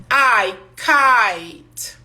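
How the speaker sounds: kite said as kaite; English kite with the ai diphthong; kite with long I. Shown as a demonstration. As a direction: glide the I in kite into ai. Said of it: "mas com E no final".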